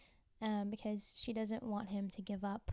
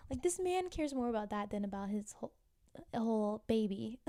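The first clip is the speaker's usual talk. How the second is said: ordinary delivery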